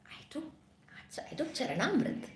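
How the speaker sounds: soft, hesitant admission; very quiet acted confession; meek confession. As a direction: own up timidly, with halting pauses. King meekly confesses to angry sage.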